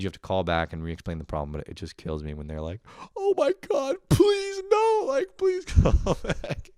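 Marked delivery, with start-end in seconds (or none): 3.2-6.7 much higher, slow shouted begging; desperate plea. Retold or imitated. Customer begging tech support: high mock desperate shout. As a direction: wail it like your life depends on it.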